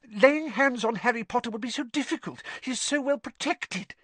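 high, quick, eager servant voice; relieved. A narrator's reading. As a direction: press the point in a squeaky rush. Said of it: "sounding thoroughly relieved now".

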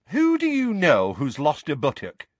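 high, eager question; interested. A narrator's reading. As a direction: ask with keen, amused curiosity. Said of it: "asked Mad-Eye interestedly".

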